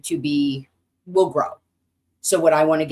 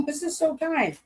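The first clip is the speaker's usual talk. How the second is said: higher, laughing gratitude; laughing thanks; 11 semitones higher, 4 dB quieter than usual. Real, not acted. Thanking an unknown sender, higher and laughing. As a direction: thank them through a laugh.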